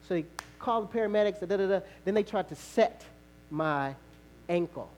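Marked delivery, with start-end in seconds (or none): none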